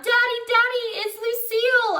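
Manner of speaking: high, loud, thrilled cry; excited holler. A narrator's reading. Girl spots best friend and hollers to Daddy, excited. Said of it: "I hollered".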